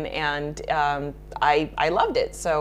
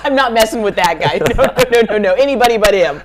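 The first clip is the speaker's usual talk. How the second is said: louder, laughing through the words; 5 semitones higher, 10 dB louder, faster than usual. Real, not acted. Laughing off the idea of hitting Steven Seagal, loud. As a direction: laugh it off loudly.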